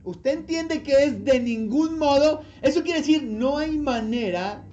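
fervent push; insistent preaching. Real, not acted. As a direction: drive the point home. Preacher presses the point.

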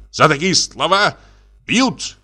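very high-pitched threat; indignant squeal. A narrator's reading. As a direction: squeal it out, deeply affronted. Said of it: "взвизгнул Абогин". (угрожающе, срываясь на визг)

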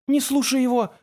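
high-pitched, frantic warning; urgent exclamation. A narrator's reading. Girl warns friend against the creature, frantic exclamation. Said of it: "воскликнула Тамара".

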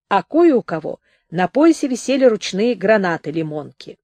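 plain reading voice

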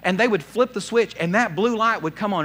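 usual speaking voice

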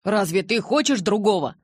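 heated question; shouting. A narrator's reading. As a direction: demand an answer, voice up. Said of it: "Джейкоб тоже перешёл на крик".

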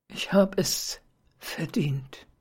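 slow, breathless dying whisper; a stabbed woman moaning her last words; labored moan. Acted. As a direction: barely get the words out, dying. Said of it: "stöhnte sie mühsam".

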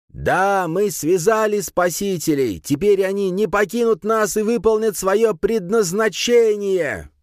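high, drawn-out fanatical cry; shouted proclamation. A narrator's reading. Priest proclaims the saviours bound, shouting high and slow. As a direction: cry it to the heavens, stretching the words. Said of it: "Затем он закричал, воздев руки к небу".

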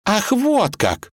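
high, drawn-out outrage; enraged. Acted. Vasilisa flares up at Ivan in a quarrel, enraged. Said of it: "разъярилась Василиса".

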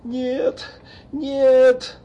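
high, loud desperate yell; shouting no. A narrator's reading. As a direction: scream the refusal. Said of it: "закричал Палмер". (отчаянный крик)

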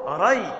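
"ري" said "ray", fatha on the ر; correct vowel. Shown as a demonstration. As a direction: open the ر into an ay diphthong in "ري". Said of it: "وليس بكسر الراء". («ري» بفتح الراء)

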